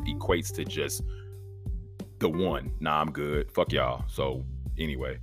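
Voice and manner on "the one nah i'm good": ordinary delivery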